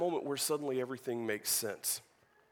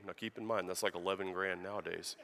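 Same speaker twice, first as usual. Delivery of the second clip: ordinary delivery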